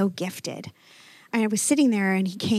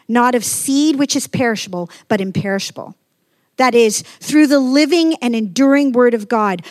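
preaching scripture; 6 dB louder than usual. Acted. Pastor reading First Peter to the congregation, preaching loudly. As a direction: proclaim it to the whole room.

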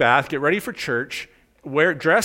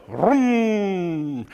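chainsaw vroom; 5 semitones higher than usual. Retold or imitated. Imitating chainsaw starting.

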